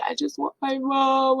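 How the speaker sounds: plaintive imitation of herself crying; retold crying. Patient on the table wanting mom, mock crying. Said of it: "I was crying".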